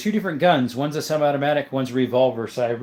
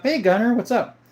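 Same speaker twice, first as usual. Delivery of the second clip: bright greeting pitched well up; cheery greeting; 9 semitones higher than usual. Real, not acted. Streamer greets the chatter Gunner back, pitched high and cheery. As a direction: call out a happy hello to the viewer.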